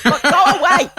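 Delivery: shooing, laughing. Retold or imitated. Mum shooing drunk men out of the kitchen. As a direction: shoo them off, half laughing. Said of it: "And she'd be like".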